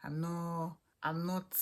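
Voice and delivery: usual speaking voice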